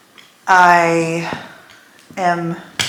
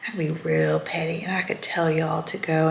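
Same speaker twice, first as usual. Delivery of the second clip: a much softer, secretive hush; conspiratorial whisper; 10 dB quieter than usual. Real, not acted. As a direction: lean in and whisper the petty idea. Confiding the petty temptation.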